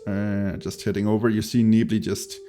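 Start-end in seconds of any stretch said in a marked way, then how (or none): none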